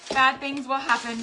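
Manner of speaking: a squeal then a loud mock warning; mock ominous. Joking to viewers that tossing rings means disaster, mock ominous. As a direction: warn them like doom is coming.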